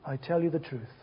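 low, soft, measured assurance with pauses; gentle solemn promise. Retold or imitated. Jesus answers the dying thief.

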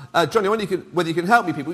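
normal talking voice

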